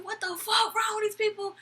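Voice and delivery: very high-pitched imitation of an aggrieved drunk; mock drunken whining. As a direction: whine as the outraged customer. Drunk man complaining about the staff.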